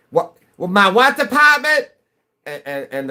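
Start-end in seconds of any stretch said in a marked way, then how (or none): 0.1-1.9 very high, loud mock-confused New England accent; baffled New Englander. Dealership worker baffled by the caller, hammed-up New England accent. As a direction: squawk it in disbelief, dropping the R, New England style. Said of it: "the guy on the other end will go".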